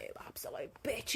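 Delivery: hissed under the breath, half laughing; a whispered jab at someone who upset you; whispered insult. Retold or imitated. Acting out an imagined shower row: whispered, laughing insult. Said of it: "have a full fight in my head".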